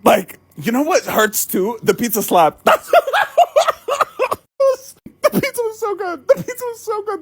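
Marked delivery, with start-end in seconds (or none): 2.6-7.2 mock wailing. Mock crying over the pizza.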